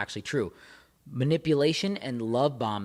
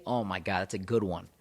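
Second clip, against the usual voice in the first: normal talking voice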